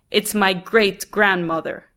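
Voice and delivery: plain reading voice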